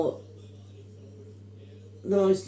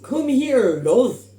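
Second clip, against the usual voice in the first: loud, high-pitched attempt at Irish; terrible Irish accent; 8 semitones higher, 10 dB louder, much slower than usual. Imitating an Irish steward who finds a lost passenger, terrible Irish accent. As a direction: call it out in a bad, overblown Irish accent. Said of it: "a really terrible Irish".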